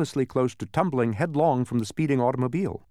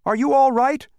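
high, loud worried call; raised-voice call to check on someone; shouting; 13 semitones higher, 8 dB louder than usual. A narrator's reading. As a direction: shout the question out. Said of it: "shouted Milo".

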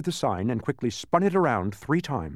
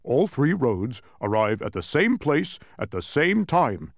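louder, slow, emphatic announcement; measured, forceful answer, pitched up; interrupting Tok; 5 semitones higher, 5 dB louder, slower than usual. A narrator's reading. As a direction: cut in firmly with the solution. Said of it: "interrupted Tok".

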